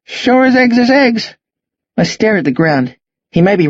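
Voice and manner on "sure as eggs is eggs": put-on higher, breezy old Australian; old Aussie bloke. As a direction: toss it off like a cheerful old farmer. Jack's breezy assurance.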